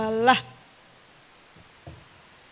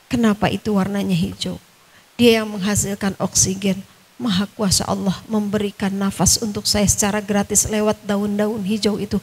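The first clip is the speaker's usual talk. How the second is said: normal talking voice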